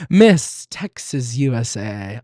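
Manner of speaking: loud, slow mock pageant introduction; pageant contestant announcement. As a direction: announce yourself like a pageant queen, drawn out. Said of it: "I stood up and I was like".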